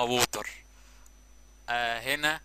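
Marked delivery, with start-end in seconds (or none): 0.0-0.5 ووتر with a rounded o and a clear final r, Egyptian style; Egyptian accent. Shown as a demonstration. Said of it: "واحنا المصريين بننطقوها". (نطق ووتر باللكنة المصرية)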